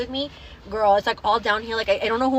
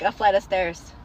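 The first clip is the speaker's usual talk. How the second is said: ordinary talk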